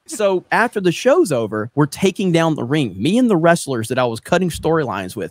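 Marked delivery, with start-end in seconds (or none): none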